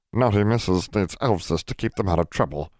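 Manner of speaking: small, high elf voice; house elf voice. A narrator's reading.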